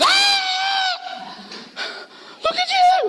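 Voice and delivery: very high, shrill-free shriek of a startled woman; shocked lady shrieking. Retold or imitated. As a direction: gasp and shriek high at the boy's arm. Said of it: "She goes".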